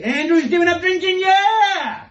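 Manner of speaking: high, loud mock cheer; hammed-up celebratory cheer; cheering crowd. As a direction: whoop it like an American crowd. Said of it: "I'd be carried shoulder high".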